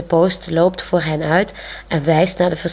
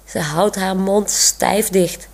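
ordinary delivery, nothing marked

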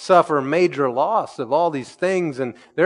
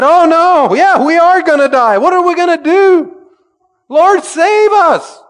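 high, loud frantic cry; mock panic; 12 dB louder than usual. Pastor mocking us waking in panic, frantic high cry. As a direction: ham up the terror of drowning.

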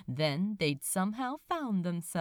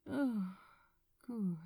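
mumbled; 4 dB quieter than usual. Acted. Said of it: "Azra mumbled against his chest".

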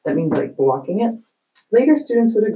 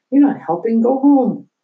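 higher, louder, exasperated snap; annoyed father; 8 semitones higher, 5 dB louder than usual. Acted. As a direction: shoo the son off impatiently. Father snapping at son.

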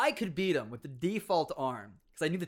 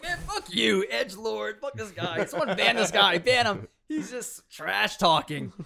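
higher, louder mock outrage; angry players yelling; 6 semitones higher, 7 dB louder than usual. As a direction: yell for a ban, mock furious. Angry players shout at troll.

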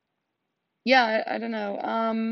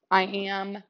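ordinary delivery

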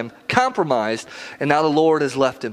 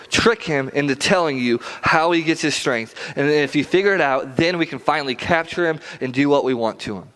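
ordinary talk

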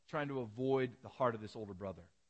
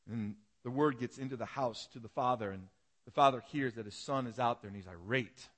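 ordinary delivery

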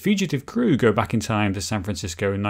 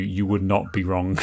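ordinary talk

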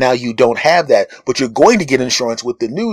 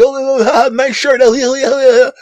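much higher gushing compliment with a fake laugh; fawning cashier; 12 semitones higher, 4 dB louder than usual. Cashier flattering a well-dressed customer, high and gushing. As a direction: coo over the shirt like a sycophant. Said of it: "The person at the cash register".

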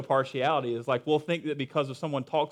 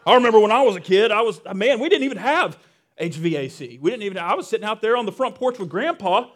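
loud, raised-pitch mock old-timer rant; grumbling senior; 4 semitones higher, 9 dB louder than usual. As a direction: complain like an elder looking down on the kids. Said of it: "the senior saints will just, like, look down".

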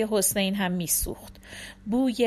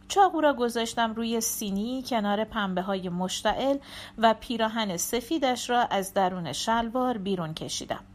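ordinary delivery, nothing marked

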